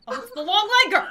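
high, fast, loud yelp; panicked. Real, not acted. Player spots the long leg girl, panicked yelp. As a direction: blurt it out in alarm. Jump scare in horror game.

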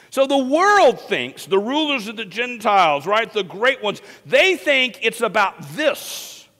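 high, loud, animated sermon delivery; fired-up preaching. Real, not acted. Preacher retelling the disciples' power play, fired up. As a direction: preach it at full force, punching key words.

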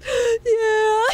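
very high squeal then cackle; high squeaky retold yeah. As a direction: squeal it like the friend. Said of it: "She goes".

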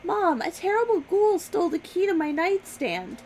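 put-on high, loud little-girl voice; little girl shouting. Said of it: "a little girl's voice shouting".

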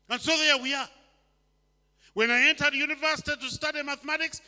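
loud preaching, pitch up; preaching at full force. Acted. Preacher warning the congregation about sin, loud and driving. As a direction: hammer the point home to the hall.

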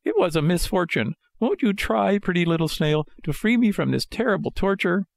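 high, sorry puppet voice; high-pitched, wheedling marionette; pleading Pinocchio. A narrator's reading. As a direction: beg sweetly in a small high voice.

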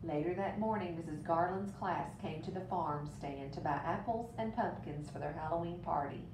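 ordinary delivery, nothing marked

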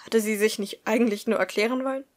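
ordinary delivery, nothing marked

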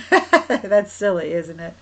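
real chuckle into the words; loud, tickled laugh, then talking through it; amused laughing. Real, not acted. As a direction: laugh at your own silly suggestion. Laughs at own silly ostrich guess.

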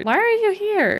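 high squeal of surprise; surprised. Real, not acted. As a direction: greet a surprise visitor with glee. Friend at door at midnight.

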